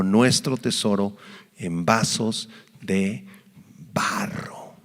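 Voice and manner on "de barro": word breathed as a whisper. Acted. Preacher finishing the verse for his flock, whispered. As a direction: drop the last word to a whisper.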